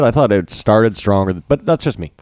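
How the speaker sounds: normal talking voice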